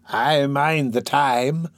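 slow reminiscing crone; an old hag recalling fondly; old woman voice. Acted.

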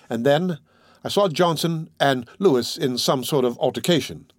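ordinary delivery, nothing marked

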